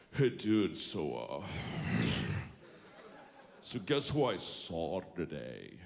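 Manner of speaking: low, dragging dude voice; imitating slack talker. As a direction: let the words slide out low and lazy. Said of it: "that's how he said it".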